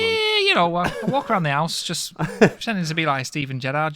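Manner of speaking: put-on Steven Gerrard Scouse accent. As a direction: Liverpool footballer Scouse accent. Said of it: "how often do you practice your Scouse accent".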